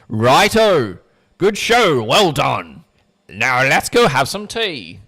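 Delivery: loud, hammed-up British accent; butchered posh British cheer, loud and slow; bad British accent. Rona dismisses the case in a hammed-up British accent. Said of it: "I butchered that accent".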